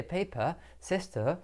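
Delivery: soft British English demo, no final r; British accent. Shown as a demonstration. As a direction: drop the r's like a Londoner, British style. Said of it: "en inglés británico, fíjate".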